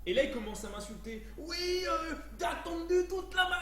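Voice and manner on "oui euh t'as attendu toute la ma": put-on high, loud caricatured Chinese accent; mock Chinese accent. Indian restaurant man insults his customer, mock Chinese accent. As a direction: imitate an angry caller in fake Chinese accent. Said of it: "je fais un accent chinois".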